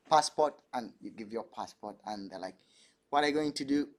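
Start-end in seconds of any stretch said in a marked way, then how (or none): none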